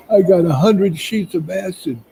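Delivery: high, panicky imitation; freaking out. Panicked passenger confessing to his buddy, freaking out. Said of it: "he's, like, freaking out".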